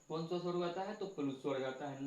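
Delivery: ordinary talk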